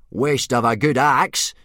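a hint of a grumbling Viking teacher; grunted. A narrator's reading. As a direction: grunt it out like a gruff old warrior. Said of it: "grunted Gobber".

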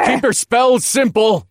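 high, loud shouted order; urgent yell. A narrator's reading. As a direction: yell it over a battle. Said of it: "I yelled".

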